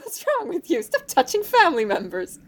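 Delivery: mock-shocked high-pitched reproach; appalled Creon. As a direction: cry out in horrified disbelief. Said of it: "Creon is just like".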